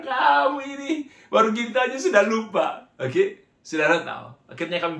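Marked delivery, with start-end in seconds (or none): 0.0-2.9 high, loud-ish exasperated teasing; mock scolding. Older brother to friend who forgot his car, mock scolding. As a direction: chide a friend in a hammed-up high voice.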